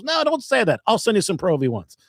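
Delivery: high-pitched, insistent retold protest; insistent offer. Golf executive offers the comic free balls, insistent offer. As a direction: wave the remark away, voice jumping up.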